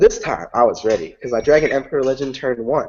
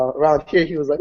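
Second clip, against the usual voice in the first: ordinary talk, nothing marked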